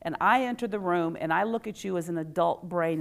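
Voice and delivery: normal talking voice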